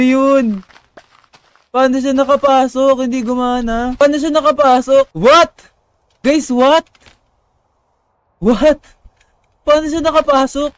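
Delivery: high, loud, incredulous questions; alarmed disbelief. Real, not acted. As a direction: blurt it out in loud shock. Disbelief at monster getting in. (gulat at di makapaniwala)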